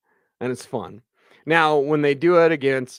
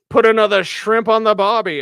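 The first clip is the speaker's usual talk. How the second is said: hammed-up Australian accent, higher and louder; 9 semitones higher, 7 dB louder than usual. Answering an Australian viewer in a mock Australian accent. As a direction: belt out a mock Aussie catchphrase. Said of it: "That's a great accent".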